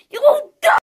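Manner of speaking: shrieked mock threat; high furious threat. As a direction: scream a threat. Threatening the duck.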